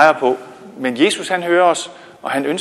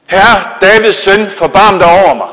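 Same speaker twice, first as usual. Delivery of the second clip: calling out again; 4 semitones higher, 7 dB louder than usual. Acted. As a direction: stretch each word as you cry out. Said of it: "så råbte han".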